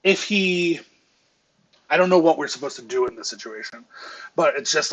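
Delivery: normal talking voice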